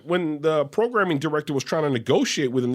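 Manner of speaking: ordinary talk, nothing marked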